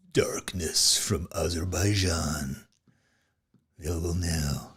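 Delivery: slow, whispered trailer voiceover; movie trailer whisper. Pretend. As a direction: breathe it like a dark trailer tease. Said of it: "movie trailer voiceover effect".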